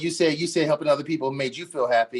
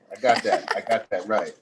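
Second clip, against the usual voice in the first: usual speaking voice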